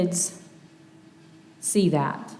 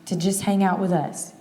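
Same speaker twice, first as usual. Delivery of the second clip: normal talking voice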